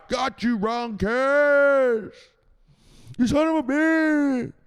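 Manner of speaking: high, loud, slow taunt; drawn-out gloating yell. Real, not acted. Streamer gloating at a banned viewer, loud and slow. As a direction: stretch it out mocking the viewer.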